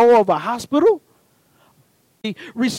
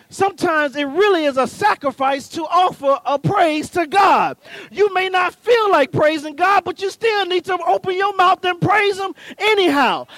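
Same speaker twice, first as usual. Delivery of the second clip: preaching; 5 semitones higher than usual. Acted. Preacher building intensity.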